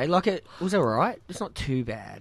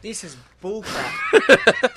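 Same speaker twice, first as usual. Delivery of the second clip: slow, drawn-out protest; 7 semitones higher, much slower than usual. Real, not acted. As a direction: complain it's unfair, dragging it out. Losing the trivia game, protesting.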